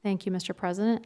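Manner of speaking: usual speaking voice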